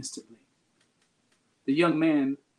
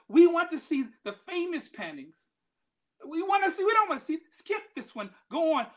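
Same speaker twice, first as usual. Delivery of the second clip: heckler shouting; 12 semitones higher than usual. Retold or imitated. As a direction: bark out the impatient bidder. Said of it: "a voice in the back of the room shouted".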